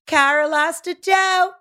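slow, high-pitched drawn-out complaint; exaggerated Debbie Downer lament; mock wailing neighbor. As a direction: drag out the bad news in a high voice. Mocking the neighbor's constant complaint.

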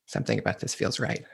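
dead serious, soft and low; deadpan. Retold or imitated. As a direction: say it with a straight face. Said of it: "such a straight face and I go".

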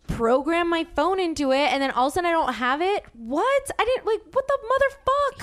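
high, incredulous outburst; exasperated, high-pitched protest; indignant disbelief. Retold or imitated. Acting out indignation at losing the car.

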